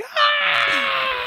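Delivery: long, very high shriek; piercingly high mock scream; imitated scream. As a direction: mimic his high-pitched scream. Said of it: "But his scream".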